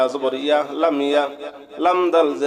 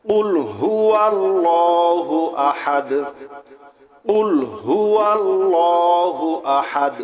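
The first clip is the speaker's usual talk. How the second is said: slow, melodic tajweed chant; slow Quranic recitation; 3 semitones higher, much slower than usual. Shown as a demonstration. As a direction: draw out each word in recitation. Model recitation.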